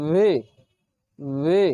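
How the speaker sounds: teacher buzzes the v in ভি; English V. Shown as a demonstration. Teacher shows the lip and teeth position for V. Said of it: "উপরের দাঁত নিচের ঠোঁটের উপরে স্পর্শ করে".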